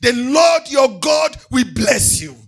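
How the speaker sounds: loud, chanting preacher's cry over music; thundering pulpit declaration of blessings; full-force preaching. Acted. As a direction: proclaim every blessing to the congregation at full force.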